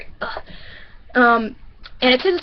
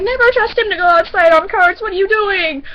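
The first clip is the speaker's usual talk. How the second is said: higher and louder, incredulous; exasperated outburst; 9 semitones higher, 5 dB louder than usual. Retold or imitated. Teller to manager about Ryan, exasperated and louder. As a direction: blurt it out in disbelief.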